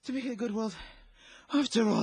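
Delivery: high feverish muttering; lost in a fever dream; delirious. Acted. Feverish soldier waking delirious.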